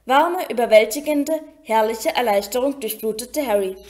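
ordinary delivery

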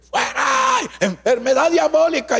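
high, drawn-out showy cry; hammed-up exorcism yell; mock healer's yell. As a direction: imitate a theatrical faith healer casting out sickness. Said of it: "comienzan a gritar puro show".

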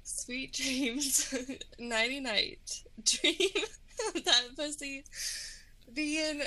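giggling through the goodbye; laughing so hard the words break up; sign-off lost to giggles. Real, not acted. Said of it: "I can't stop laughing".

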